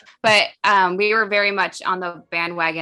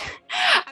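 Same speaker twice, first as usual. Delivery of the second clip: laugh. Real, not acted. Laughing before self-quote.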